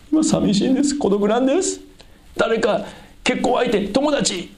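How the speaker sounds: high-pitched, hammed-up plea; lonely pleading. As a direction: act out someone desperate for company, voice way up. Acted lonely person pleading to God.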